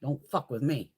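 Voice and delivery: ordinary talk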